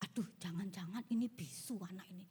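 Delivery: low, hushed worried aside; whispering doctors, imitated. Doctors conferring about a silent newborn, mock whispering. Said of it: "dokter ini bisik-bisik".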